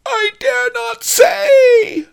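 slow, very high, loud wail; terrified wail. A narrator's reading. Pawnbroker too frightened to name the thief, wailing. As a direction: wail it out in dread. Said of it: "Fang wailed".

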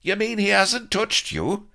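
very high, loud disbelief; can't believe what he hears; incredulous. A narrator's reading. Blood amazed that Pugh never touched Vanity, incredulous. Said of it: "Blood was incredulous".

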